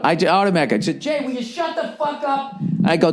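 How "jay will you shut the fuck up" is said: high yell, as if away from the mic; re-enacted yell. Driver yelling at his friend from the truck, re-enacted high yell. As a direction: act out snapping at a friend in a high-pitched yell. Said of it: "And I just yelled out".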